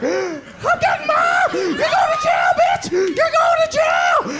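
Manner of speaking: high, drawn-out shouting; mock hysterical threat. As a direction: squeal it like a tattling kid. Teller fleeing to fetch mom.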